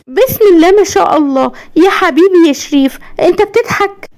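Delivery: high-pitched, amazed motherly cry of joy; delighted mother. Acted. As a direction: marvel at your son, voice lifted high. Mother amazed her son is laughing.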